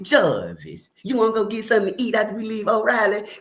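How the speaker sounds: high, slow pleading relatives; high mock pleading to Jarvis; whiny family. Imitating family begging Jarvis.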